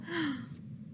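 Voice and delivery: sharp breathy gasp of alarm. Acted. Reading to children, a quick alarmed gasp. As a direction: gasp as if spotting trouble. Reader gasps at trouble.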